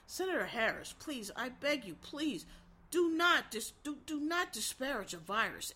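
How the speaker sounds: hushed, sober, rational-sounding mimicry; calm preacher voice. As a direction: imitate a politician's soft, measured plea. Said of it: "in that calm, sober, rational tone".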